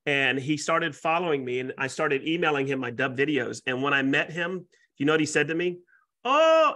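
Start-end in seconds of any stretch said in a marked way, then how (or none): 6.2-6.7 high, loud starstruck "Oh"; excited gasp. Retold or imitated. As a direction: mimic the client's thrilled gasp. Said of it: "I'm not gonna do his accent".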